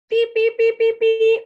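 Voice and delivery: high, loud "Beep, beep, beep, beep, beep"; excited beeping for the children; loud truck horn. Pretend. Little Blue's horn in storytime.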